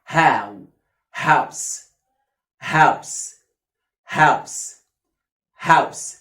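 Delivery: "هاوس" with long open ow; word model. Shown as a demonstration. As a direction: say "هاوس" ending on s, silent e. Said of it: "ما بنطقش ال إي في الآخر خالص". (نطق "هاوس" بمد آو)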